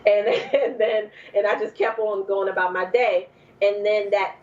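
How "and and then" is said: normal talking voice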